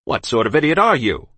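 loud, sharp snap; snapped. A narrator's reading. The captain snaps at the girl while twisting her ear. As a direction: bite the insult off. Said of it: "he snapped".

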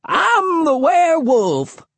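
slow, high-pitched crazed shriek; wild hermit's drawn-out shriek. A narrator's reading. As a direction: shriek it wildly, stretching each word. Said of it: "he shrieked".

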